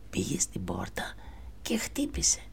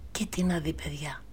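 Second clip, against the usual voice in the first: usual speaking voice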